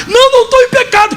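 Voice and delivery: high-pitched imitated crying protest; mock a weeping woman protesting; tearful denial. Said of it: "Começou a chorar". (imitando protesto choroso e agudo)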